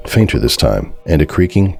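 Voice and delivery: normal talking voice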